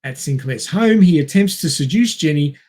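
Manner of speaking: normal talking voice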